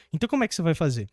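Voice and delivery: normal talking voice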